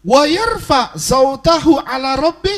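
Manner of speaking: high, loud indignant recitation; angkat suara. Retold or imitated. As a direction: raise your voice well above normal. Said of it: "dia angkat suara begitu". (suara lantang bernada tinggi)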